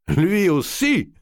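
he can't believe the king owes money too; cried out in surprise. A narrator's reading. Count d'Artois cries out to the banker in surprise. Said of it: "s'écria d'Artois".

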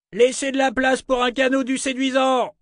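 very high-pitched holler; strained, high shout; bawling. Acted. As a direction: bawl it across the water to the boats. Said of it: "brailla le marchand Larfa".

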